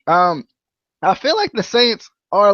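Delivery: ordinary talk, nothing marked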